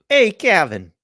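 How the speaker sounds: loud, high Southern holler; badly faked Southern accent, called out. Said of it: "You can't do a Southern accent".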